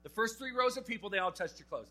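indignant disciple spelling out the obvious; exasperated Peter. Retold or imitated. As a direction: blurt it out like baffled Peter in the crowd. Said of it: "Peter sitting there going".

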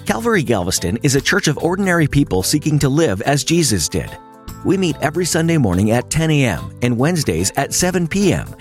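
normal talking voice

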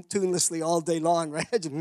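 usual speaking voice

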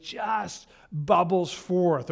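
bubbles forth stretched very slowly; savoring the image slowly; drawn out slowly. Shown as a demonstration. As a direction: let the phrase ooze out. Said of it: "literally as a translation".

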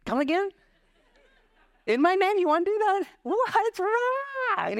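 very high, astonished disbelief with a laugh; mock-shocked rebuke of hotheaded disciples; incredulous Jesus. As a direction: react as if you can't believe your ears. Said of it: "And Jesus like".

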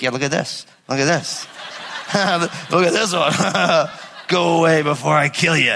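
laughing father showing off embarrassing photos; mock proud dad, laughing. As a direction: chuckle as you threaten the boyfriend. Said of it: "be like".

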